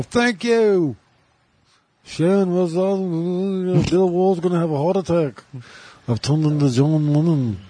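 high, mumbling rock-star impression. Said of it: "Singing or mumbling really hard".

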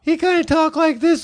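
very high, squeaky teen impression; pubescent boy voice. Shown as a demonstration. Storyteller demonstrating a teenage friend's voice, very high squeak. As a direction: talk way up high like a voice mid-puberty. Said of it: "his voice at that time was just".

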